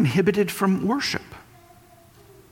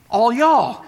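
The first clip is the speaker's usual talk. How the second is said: all y'all punched louder, falling; all y'all, hard emphasis; emphatic all y'all; 4 semitones higher, 4 dB louder than usual. Retold or imitated. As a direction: stress it like a Texan meaning everyone.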